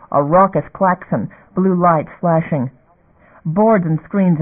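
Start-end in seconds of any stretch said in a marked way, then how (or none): none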